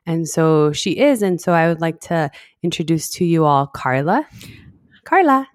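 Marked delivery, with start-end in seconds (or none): 5.0-5.5 a high, loud, showy call of her name; excited announcement. Real, not acted. Podcast host introduces her co-host with a loud, high call. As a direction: present her like a host bringing on a guest.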